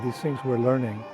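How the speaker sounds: normal talking voice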